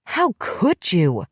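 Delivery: slow, pleading furious cry; shaking her by the shoulders, repeating it; screamed again. Acted. Shaking her friend, a slow repeated anguished cry. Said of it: "It was all I could say over and over again".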